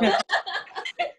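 high squealing laugh. Real, not acted. Laughing at her own boast, high squeal.

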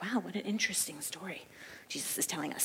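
unvoiced mock whisper of the clueless leaders. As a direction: breathe it out as a hushed aside. Clueless leaders' inner reaction.

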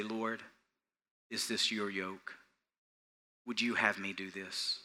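soft, low, unhurried prayer; prayerful. Shown as a demonstration. Preacher models praying before saying yes, prayerful. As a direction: quietly ask the Lord for direction. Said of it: "stop and pray and say".

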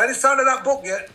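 a cruel father nagging his son about the unwritten book. Retold or imitated. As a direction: needle him like a cruel dad. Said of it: "my father, who was much crueler".

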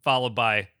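usual speaking voice

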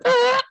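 sudden high yelp of fright; high panicked "Ah!"; startled scream. Retold or imitated. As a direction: shriek as the car screeches up. Car stops, sudden scare.

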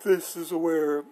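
words stretched through a real yawn. Real, not acted. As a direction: say it mid-yawn, mouth wide open. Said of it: "I'm yawning".

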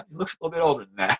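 fast, laughing aside. Real, not acted. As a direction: chuckle while saying it. Joking about dad's photo.

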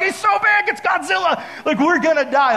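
high, loud terrified shout, re-enacted; panicked. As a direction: shout it like a hammed-up freak-out. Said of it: "Like, I'm so nervous".

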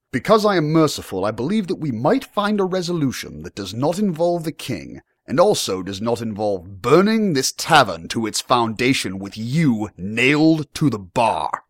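high, emphatic veiled threat; lordly menace, drawing out the last words; menacing young lord. Acted. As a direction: offer mercy as a threat, slowing at the end.

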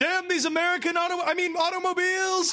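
hammed-up high shout at American cars; mock furious shout. Father cursing American cars after his son's death. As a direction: curse the cars, overacting.

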